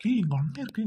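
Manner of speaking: indignant retort. Acted. Fiancé indignantly shouting back at the bickering girl. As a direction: snap back at her. Sibling squabble, first retort.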